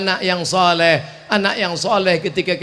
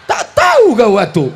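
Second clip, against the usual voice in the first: louder and higher scolding; loud rebuke; 5 semitones higher, 9 dB louder than usual. Real, not acted. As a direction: shout it out sharply. Preacher shouts rebuke.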